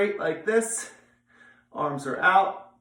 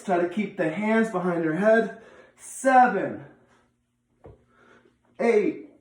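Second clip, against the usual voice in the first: barking gym coach; 4 semitones higher, much slower than usual. Real, not acted. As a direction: call out each rep like a coach. Coach counting sit-up reps.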